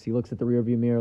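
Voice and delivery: usual speaking voice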